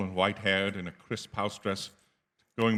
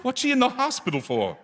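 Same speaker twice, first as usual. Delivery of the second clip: a much higher, urgent cry of alarm; alarmed demand; 12 semitones higher than usual. Acted. Son pressing the stranger, frightened for his mother. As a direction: blurt it out in fear.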